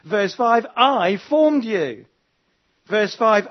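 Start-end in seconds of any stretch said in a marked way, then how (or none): 0.8-2.1 long, loud, raised "I"; stress on I. Shown as a demonstration. God speaking to the prophet, strong stress on I. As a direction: proclaim it slowly, leaning on "I". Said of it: "the emphasis is on God".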